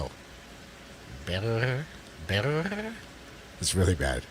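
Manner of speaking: Berto with a gringo accent and a stuck r; Berto, trying to roll the r; american accent spanish. Shown as a demonstration. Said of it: "You sound like a gringo".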